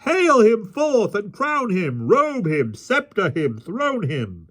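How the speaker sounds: high jeering calls, gradually easing down; crowd cries. A narrator's reading. Rabble calls for a mock coronation, high cries. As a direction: cry each command out high, the first drawn out. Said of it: "These and twenty other cries broke out".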